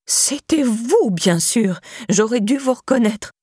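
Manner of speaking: put-on high, accusing old-woman voice; excited old woman. A narrator's reading. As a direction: pounce with sudden recognition. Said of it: "s'exclama alors la vieille chouette".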